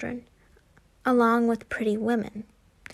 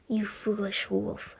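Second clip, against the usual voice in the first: quiet, slow cold sneer; cold scorn; 2 semitones lower, 5 dB quieter, slower than usual. A narrator's reading. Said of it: "said Bellatrix with a, with a cold wa-, laugh".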